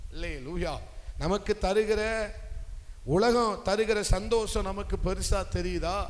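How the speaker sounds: ordinary talk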